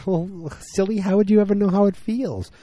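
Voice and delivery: high, mock-girlish teasing voice; high-pitched playful impression of a wife; Trudy. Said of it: "Trudy's like".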